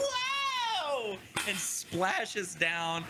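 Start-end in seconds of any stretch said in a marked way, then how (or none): 0.0-1.2 long, high "whoa" as if toppling; falling yelp. Acted. Game master as Fennel splashing into mud, drawn-out yelp. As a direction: wail it out on the way down.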